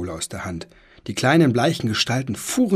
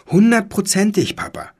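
put-on eager boy; 11 semitones higher, 5 dB louder than usual. Boy insisting to dad.

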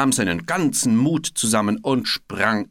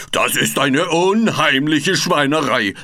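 louder, furious bellow; angry roar; 5 semitones higher, 8 dB louder than usual. A narrator's reading. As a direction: bellow your outrage. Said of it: "brüllte Frederik".

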